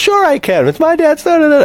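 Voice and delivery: high-pitched bratty retort; spoiled kid. Retold or imitated. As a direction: whine back like an entitled boy. Voices spoiled kid insisting he can.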